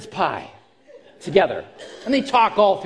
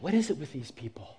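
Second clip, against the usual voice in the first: lower, much softer, fast muttered complaint; exasperated aside; 6 semitones lower, 10 dB quieter, faster than usual. Retold or imitated. Captain grumbling about stubborn swimmers, low and soft. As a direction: grumble it under your breath in disbelief. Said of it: "the captain's like".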